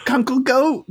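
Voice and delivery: high-pitched call to a pet; gnome commanding. Acted. Gnome orders Kunkel to scout the strangers, high calling command. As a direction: send the bat off in a high voice.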